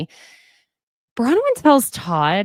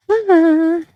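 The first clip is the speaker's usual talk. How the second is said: burst of laughter; 11 semitones higher, 8 dB louder than usual. Real, not acted. Laughing at own joke.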